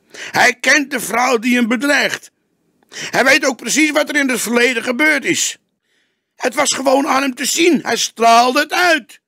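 put-on young detective arguing with the cook, agitated. A narrator's reading. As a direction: convince him at full pitch. Young detective argues heatedly.

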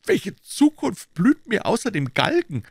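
a hint of hopelessness; despairing young Silas. A narrator's reading. Desperate Silas speaking.

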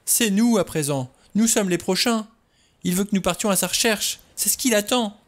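high, frantic warning; loud, panicked insistence; crying out. A narrator's reading. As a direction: plead urgently that danger is waiting. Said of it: "s'écria-t-elle".

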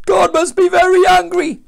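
very high, loud shout; acted panicked captain; captain's alarmed cry. A narrator's reading. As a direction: shout in alarm over the storm. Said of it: "cried the captain".

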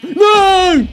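high, loud sudden no; alarmed cry. Real, not acted. Gamer seeing his wolf hurt, alarmed cry. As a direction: cry out as it goes wrong.

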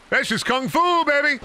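hyped gamer brag; excited gloat. Real, not acted. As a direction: shout the victory. Louder repeat of taunt.

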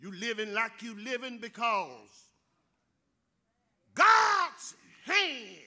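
rising, ringing preacher's cadence with loud peaks; preaching. Acted. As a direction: build the sermon to a shout. Preacher builds to full force.

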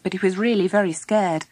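ordinary delivery

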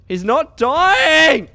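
slow, loud exasperated cry; frustrated yell. Real, not acted. Gamer whose shots do no damage, frustrated yell. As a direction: drag it out in disbelief.

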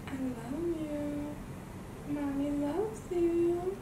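slow, high loving coo. Real, not acted. Mom cooing to baby.

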